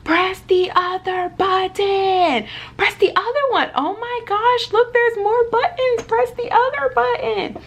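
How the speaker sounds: high, loud pleading imitation; frantic mom voice. Mom begging the toddler through the window, high and frantic. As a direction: mimic mom urging the baby frantically. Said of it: "I'm leaning in like this".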